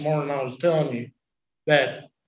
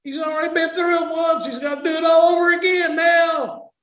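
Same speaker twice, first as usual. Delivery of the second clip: high, loud shouting sermon climax; preaching at full force; 14 semitones higher, 7 dB louder than usual. Acted. Preacher shouts to his congregation, full-force preaching. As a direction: belt it out to the congregation, much higher and louder.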